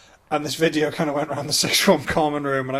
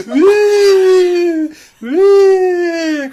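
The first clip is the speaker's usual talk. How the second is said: high loud sobbing wail; crying; 14 dB louder than usual. Retold or imitated. Crying son sat beside his dad, high loud wail.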